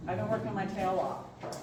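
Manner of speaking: ordinary talk, nothing marked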